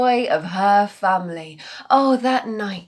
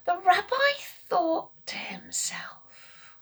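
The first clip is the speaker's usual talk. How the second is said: whisper; 7 semitones higher, 9 dB quieter than usual. A narrator's reading.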